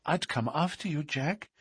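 high, soft woman's voice; devoted sweetheart. Acted. Sweetheart promises to follow Jack, soft high devotion.

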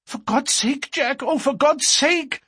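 very high, frantic plea; distraught cry. A narrator's reading. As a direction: cry out in panic to stop a fight. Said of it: "cried poor, distracted Etty".

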